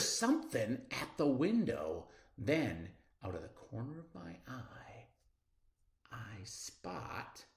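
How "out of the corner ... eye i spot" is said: soft whispered suspense; breathy whispered build. A narrator's reading. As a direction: hush it down. Tense suspense moment.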